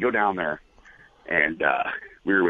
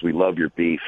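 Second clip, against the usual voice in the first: ordinary talk, nothing marked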